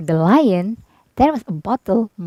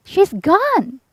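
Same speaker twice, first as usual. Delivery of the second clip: joyful cry; 8 semitones higher than usual. A narrator's reading. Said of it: "cried Dorothy".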